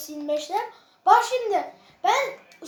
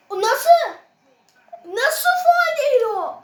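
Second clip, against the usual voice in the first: outraged shout; 6 semitones higher, 6 dB louder than usual. Real, not acted. Outraged at missed foul call.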